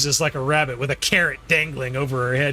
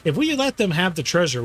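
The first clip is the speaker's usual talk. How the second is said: usual speaking voice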